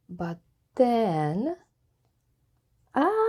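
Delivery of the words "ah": sudden high cry of alarm; falling cry. Acted. Elephant slipping off the pine, short high yelp. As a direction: yelp as he slips from the tree.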